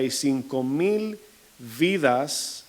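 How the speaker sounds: "mil vidas" drawn out slowly; slow, drawn-out stress on "mil"; slow emphasis. Shown as a demonstration. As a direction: stretch "y cinco mil vidas" to make it weigh. Preacher dwells on the number.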